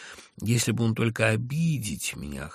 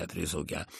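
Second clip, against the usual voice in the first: ordinary delivery, nothing marked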